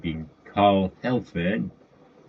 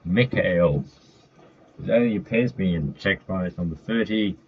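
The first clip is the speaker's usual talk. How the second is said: ordinary talk, nothing marked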